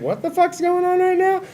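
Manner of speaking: squeaky alarmed inner voice; hammed-up startled squeal; high mock panic. Telling friends how her forcefulness caught him off guard, mock alarmed squeak. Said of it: "I was like".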